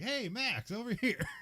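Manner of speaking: high, loud friendly call across a food court. Retold or imitated. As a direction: shout a cheery hello across the room. Jackie calling out to Max.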